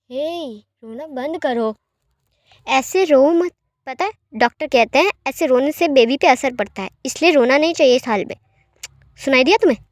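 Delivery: Wing loudly scolds crying Lan Jan, firm; firm telling-off. Acted. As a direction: scold him to stop, worried.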